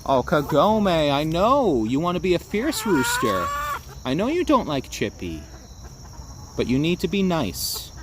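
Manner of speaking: high, gentle coaxing to a hen. Real, not acted. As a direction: sweet-talk a grumpy hen into behaving.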